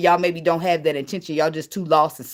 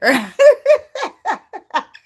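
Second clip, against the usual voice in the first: loud high cackle; 14 semitones higher, 7 dB louder than usual. Real, not acted. Laughing at weed story.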